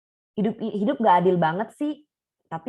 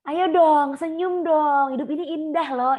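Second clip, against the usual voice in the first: much higher and louder, pushy fake cheer; forced cheerfulness; 10 semitones higher, 6 dB louder, slower than usual. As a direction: chirp it at a crying friend like a cheerleader. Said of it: "jangan juga kita langsung bilang".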